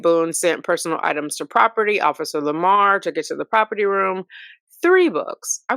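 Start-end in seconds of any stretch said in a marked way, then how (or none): 4.8-5.6 high, amazed exclamation; surprised. Real, not acted. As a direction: pitch jumps up in disbelief. Reading surprising property list.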